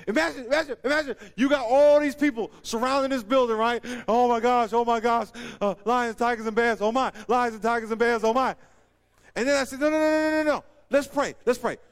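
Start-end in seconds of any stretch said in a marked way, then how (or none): 4.1-8.5 loud, high hammed-up fretting; mock panic. Acting frightened servant surrounded by army. 9.8-11.8 loud, high string of refusals; urgent interruption. Acted. Acting calling the panicker to pray.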